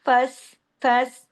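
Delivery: फस with a thin vowel and a planted, held s; firm, light letters. Shown as a demonstration. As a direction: press and hold the s of फस. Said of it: "जमाओ के साथ बारीक हरूफ है". (फस जमाकर, बारीक आवाज में)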